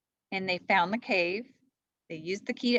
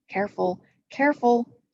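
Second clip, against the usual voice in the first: plain reading voice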